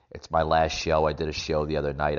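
normal talking voice

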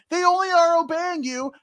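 high, forceful hero outburst; hammed-up indignant retort; mock accusation. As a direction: hurl it as a playful accusation. Repeats Percy's accusation to Zeus.

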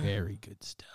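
hushed whisper. Pretend. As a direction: whisper it low. Whispered joking echo.

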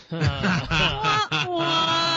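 imitated wah-wah for a lame punchline; mock sad-trombone wah-wah. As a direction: groan out a comic trombone sting. Mock trombone after a bad joke.

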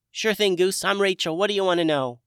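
high, bright fairy voice; cheery sprite voice. A narrator's reading. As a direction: chirp it like a tiny friendly sprite. Rachel voiced.

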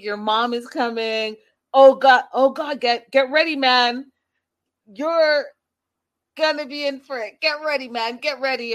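excited mock taunt; hyped-up trash talk. Brother hyping up his sibling, mock taunting. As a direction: hype it up. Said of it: "he's like".

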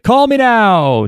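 high, drawn-out psychic hotline catchphrase; stretched-out, high-pitched catchphrase call; Miss Cleo impression. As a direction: belt it out slow and high like a TV pitch. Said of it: "did not know who Miss Cleo is".

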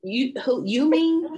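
mock disbelief, high and loud; incredulous. Real, not acted. Teasing her friend for claiming to be mean, high.

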